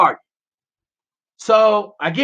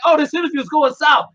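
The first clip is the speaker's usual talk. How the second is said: higher, flustered mock panic; 8 semitones higher than usual. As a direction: play a nervous interviewee losing it. Imagined panicked self in interview.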